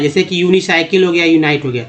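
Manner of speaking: ordinary talk, nothing marked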